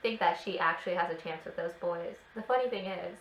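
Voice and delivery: ordinary talk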